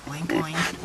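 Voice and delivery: low little pig oinks. Pretend. Mum showing the piggy's sound, oinking. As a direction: oink playfully like a pig. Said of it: "what sound does a piggy make".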